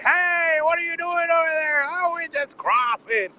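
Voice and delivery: a hammed-up shout across the fence; mock call-out. Onlookers calling to the border crossers, mock shout. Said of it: "Everybody was like".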